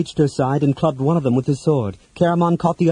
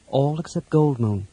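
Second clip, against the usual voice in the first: ordinary delivery, nothing marked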